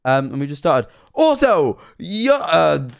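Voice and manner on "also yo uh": high, loud thank-you burst; excited. Real, not acted. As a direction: pump up the shoutout. Thanking a subscriber, hyped.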